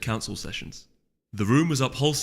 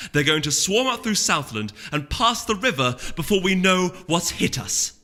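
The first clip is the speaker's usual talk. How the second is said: loud, high urgent argument; urgent warning; 7 semitones higher, 4 dB louder than usual. Acted. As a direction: hammer the danger home. Celerity arguing war is coming.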